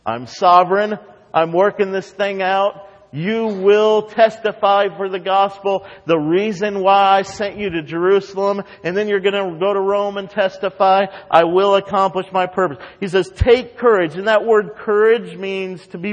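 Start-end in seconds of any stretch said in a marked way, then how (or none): 0.0-12.8 higher, louder commanding reassurance; the Lord telling Paul to take courage. Acted. Preacher voicing the Lord. 13.3-14.2 take courage again. Acted. Repeating the Lord's words.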